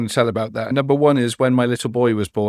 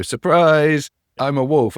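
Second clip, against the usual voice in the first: a higher, louder playful "Surprise, I'm a wolf"; mock surprise; 4 semitones higher, 5 dB louder, slower than usual. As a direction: jump out with a playful reveal. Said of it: "you jump out and go".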